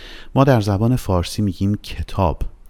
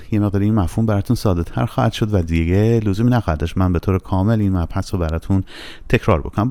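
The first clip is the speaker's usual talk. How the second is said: ordinary talk, nothing marked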